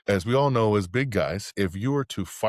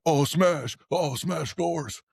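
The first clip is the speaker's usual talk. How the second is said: high-pitched, drawn-out mocking taunt; mocking teasing; 9 semitones higher, much slower than usual. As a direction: imitate teammates making fun, pitched way up. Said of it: "they were making fun of me".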